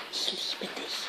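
hushed, breath-only prompting; very soft whispered love words; conspiratorial whisper. Acted. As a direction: whisper it like a secret temptation. Eros prompts the girl secretly.

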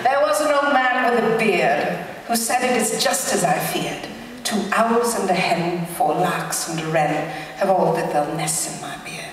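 a lecturer's playful fake British accent for the limerick. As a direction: read it like a mock Brit to the class. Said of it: "do it in a fake British accent".

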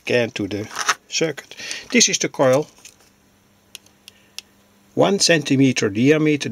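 ordinary talk, nothing marked

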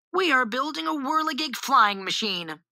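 high-pitched, proudly declaimed; proud announcement. A narrator's reading. Boy shows off the flying machine, announcing proudly. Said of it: "Willie announced proudly".

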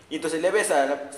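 ordinary delivery

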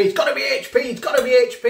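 mocking HP loyalists. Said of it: "people now who just stick to H P".

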